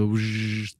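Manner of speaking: drawn-out buzzing fan noise; fan buzz imitation. Shown as a demonstration. Podcaster showing a distracting fan noise, imitated buzz.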